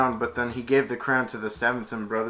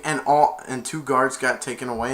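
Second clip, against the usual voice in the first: ordinary talk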